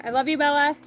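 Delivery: loud, high call to the dog. Real, not acted. To her dog Bella, a loud, high, affectionate call. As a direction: belt it out across the yard.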